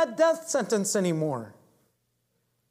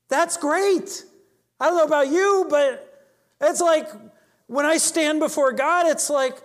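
joyful excitement; 5 semitones higher, 3 dB louder than usual. Real, not acted. Preacher rejoicing at freedom from condemnation.

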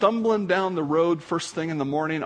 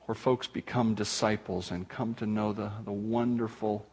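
closing prayer; 5 semitones lower than usual. Acted.

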